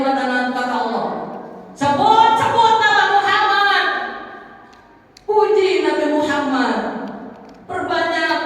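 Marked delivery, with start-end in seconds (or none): 1.8-8.4 commanding call. Acted. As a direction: urge him forcefully to say the name. Allah commanding Adam.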